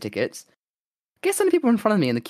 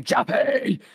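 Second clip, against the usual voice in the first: high mock scream of a name; retold panicked scream; 8 semitones higher than usual. Gamer calls to teammate during an attack, mock screaming. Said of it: "I'm just screaming".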